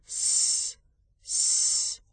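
two soft drawn-out shushes; cautioning shush. A narrator's reading. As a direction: hush someone with a soft sss. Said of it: "او را دعوت به احتیاط کرد". (هیس آهسته برای احتیاط)

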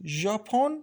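sharp rising disbelief on one word; surprised. A narrator's reading. Shohreh, stunned by her friend's news, surprised. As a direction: Shohreh gasps the name back in shock. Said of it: "با همان حالت تعجب گفت".

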